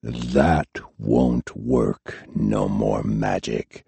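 calm, final, almost tender threat; a cold answer just before the knife; quiet menace. A narrator's reading. Quiet reply before stabbing.

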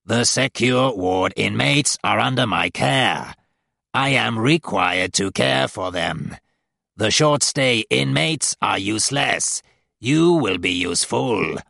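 slow, buzzing demon command. A narrator's reading. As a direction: drone it out very slowly. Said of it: "The buzzing voice".

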